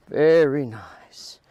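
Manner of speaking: whispered slow praise to the dog. Real, not acted. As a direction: breathe the praise, don't voice it.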